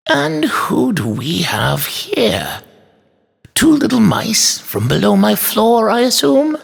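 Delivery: high, drawn-out toy maker, sternly questioning. A narrator's reading. Toy maker finds girls behind him, stern. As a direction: begin very slowly, stern but not quite angry. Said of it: "He had a stern tone".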